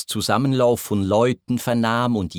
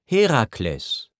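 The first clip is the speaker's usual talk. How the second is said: slow, mildly defiant answer; a touch of stubbornness, drawn out. A narrator's reading. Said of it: "Die Seele trotzte".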